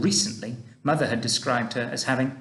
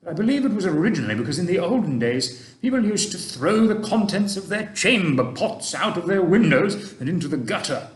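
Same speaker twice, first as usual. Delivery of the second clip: put-on a kindly old gentleman, louder and gently advising; Mister Stink; 5 semitones higher, 3 dB louder than usual. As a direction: speak like an old tramp giving fatherly advice. Mister Stink explaining chamber pots.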